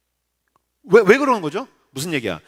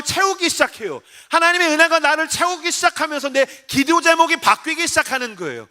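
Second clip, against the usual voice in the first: high-pitched, driving sermon build; urgent repetitive preacher's cadence; impassioned preaching; 5 semitones higher than usual. Real, not acted. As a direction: preach with mounting fervor. Preaches at full force.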